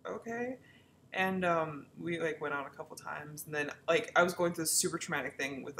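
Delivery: ordinary talk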